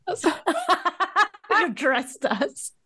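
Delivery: high laughing line through giggles; can barely get it out for laughing. Real, not acted. Friend teasing about the party dresses, laughing hard.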